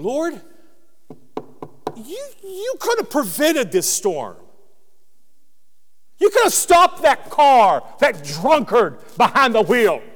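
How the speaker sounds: mock a believer blaming God; complaining to God.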